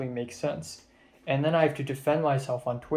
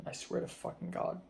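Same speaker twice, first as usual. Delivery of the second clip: hissed under the breath, much softer; angry whisper; 4 semitones higher, 12 dB quieter than usual. Real, not acted. A tired vlogger swearing quietly to himself in a whisper. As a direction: swallow the curse into a whisper.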